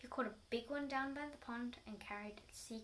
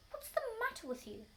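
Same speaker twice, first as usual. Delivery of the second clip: high, loud startled Mister Twit; startled shout; 11 semitones higher, 4 dB louder than usual. Acted. Husband reacts to scream.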